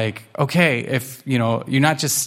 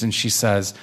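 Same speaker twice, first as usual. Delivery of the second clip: ordinary delivery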